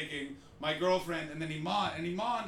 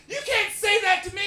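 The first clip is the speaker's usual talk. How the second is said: indignant mock shout; 12 dB louder than usual. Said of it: "she went".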